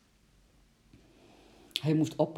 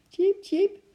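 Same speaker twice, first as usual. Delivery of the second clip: very high, tiny squeak; squeaking squirrel; 5 dB louder than usual. A narrator's reading. (hoog piepend eekhoorntje)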